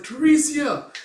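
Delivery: high, loud shout of a name; alarmed cry. Retold or imitated. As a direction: call out in sudden alarm. Acts Puck crying out her name.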